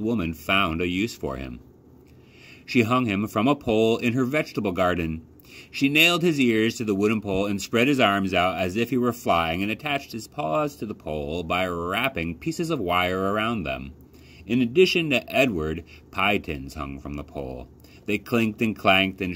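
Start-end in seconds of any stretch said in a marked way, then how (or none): none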